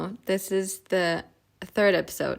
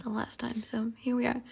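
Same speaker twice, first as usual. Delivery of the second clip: whispering; 9 dB quieter than usual. Real, not acted. Whispered aside.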